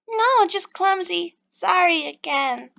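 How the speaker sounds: high, slow, drawn-out apology; sheepish apology. Acted. Pixie excusing her clumsiness to the stranger, sheepish.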